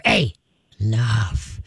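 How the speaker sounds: calm firm low warning; low and slow. Shown as a demonstration. Trainer modeling calm warning to dog, low and slow. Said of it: "stay low and slow".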